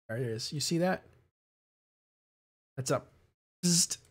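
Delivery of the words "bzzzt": short buzzing electric zap; zap sound. Retold or imitated. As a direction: imitate a crackling zap. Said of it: "That little bzzzt".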